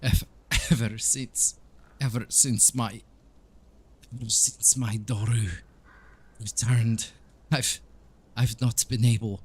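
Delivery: put-on accented priest struggling through tears; tearful Donovich. Priest confessing his lost healing powers, tearful and halting. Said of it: "he's, like, crying at this stage, he's really tearing up".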